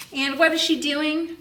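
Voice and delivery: normal talking voice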